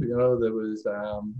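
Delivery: normal talking voice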